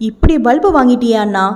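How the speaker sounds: usual speaking voice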